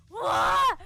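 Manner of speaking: very loud, high wordless yell; angry "wah" scream. Real, not acted. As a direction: let out a frustrated scream. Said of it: "I'm so angry".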